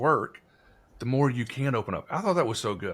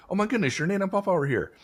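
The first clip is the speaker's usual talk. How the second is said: put-on much higher, a girl's thrilled exclamation; delighted gasp; 10 semitones higher, much faster than usual. Daughter's friend greeting her grandparents, gushing and delighted. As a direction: gush it like a kid spotting someone she loves. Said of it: "she called them by their name".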